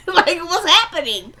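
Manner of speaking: high-pitched, laughing disbelief; amused, incredulous squeal; real laughing bafflement. Real, not acted. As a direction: ask it high, thrown by the absurdity. Baffled by the show's plot hints.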